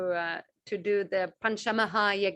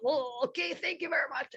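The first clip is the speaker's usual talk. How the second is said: satisfied guest; 4 semitones higher, 4 dB quieter than usual. Retold or imitated. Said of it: "that person goes like".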